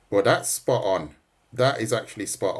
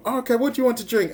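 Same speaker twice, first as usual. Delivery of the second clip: mock polite question; 12 semitones higher, much faster than usual. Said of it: "And then you say".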